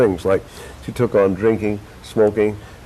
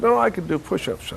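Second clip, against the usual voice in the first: a hint of a higher old man's protest; lightly voiced old man; 10 semitones higher than usual. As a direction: insist like a stubborn old man, a bit higher. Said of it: "I play a guy who's old".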